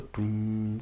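held, humming "tum"; imitated sustained chord. Shown as a demonstration. Explaining the sustain pedal, imitated held chord "tum". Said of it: "un acorde sostenido".

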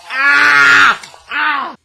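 sharp high cries of pain; loud high yelps. Real, not acted. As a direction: yelp out loud twice. Pain from carpal tunnel device.

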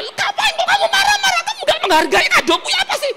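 very high, loud squawk; hammed-up offended complaint; acted indignant protest. Husband asking wife why she scorns his gift, shrieked indignation.

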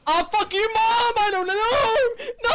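high-pitched panic, rising as it goes; panicked screaming. Real, not acted. Player chased in the game, screaming. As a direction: cry out in real fright. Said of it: "screaming like a girly girl".

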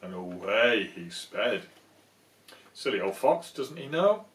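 cheeky bedtime narration; storybook narration. A narrator's reading. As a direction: relish the mouse's trick. Reading the book aloud.

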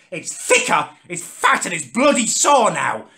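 ordinary talk, nothing marked

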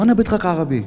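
re-enacted shouting; retold shout in Arabic. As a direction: call across in Arabic. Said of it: "אני בא צועק לו".

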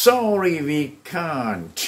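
drawn out, each word separated; slow drill model; very slow model answer. Shown as a demonstration. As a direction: stretch it slowly for students. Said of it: "ให้พูดว่า".

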